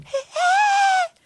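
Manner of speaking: very high, drawn-out manic squeal of a greeting; serial-killer greeting. Retold or imitated. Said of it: "smiled. Like, serial killer, like".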